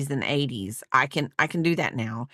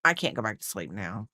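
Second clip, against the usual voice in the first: quiet groggy complaint; 7 semitones lower, 5 dB quieter than usual. Retold or imitated. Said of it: "I'm like".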